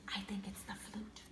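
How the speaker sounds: soft unvoiced aside; whisper. Real, not acted. Reader whispering the guess to the child, hushed. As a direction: whisper the answer like a secret.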